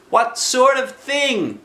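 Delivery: put-on high, slow child voice; lightly voiced boy. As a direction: answer like a gentle little boy. Christopher voiced.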